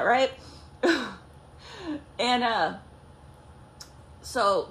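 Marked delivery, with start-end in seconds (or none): none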